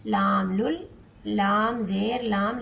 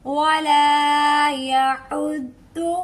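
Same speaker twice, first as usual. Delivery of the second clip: very slow, louder chanted वला यहुद्दु; Quranic recitation; 3 semitones higher, 5 dB louder, much slower than usual. Shown as a demonstration. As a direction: recite with a firm dad sound. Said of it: "ठीक है".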